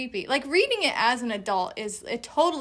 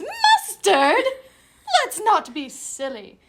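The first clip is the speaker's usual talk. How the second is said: movie character quote; 9 semitones higher, 8 dB louder, slower than usual. Retold or imitated. Quoting a tea party movie scene to a friend, theatrical character voice. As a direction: mimic a tea-party character's indignant line. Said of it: "and he goes".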